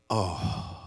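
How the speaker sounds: a soft, stunned breath of realization; stunned quiet oh. Retold or imitated. The mother, hearing the word, softly stunned. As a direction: let it drop out low and hushed. Woman realizes her lost child.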